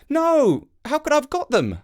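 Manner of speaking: put-on high boy's voice; a boy telling the crow about his dad, in a high child's voice; young boy. A narrator's reading. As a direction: speak like a small boy explaining. Jake protesting.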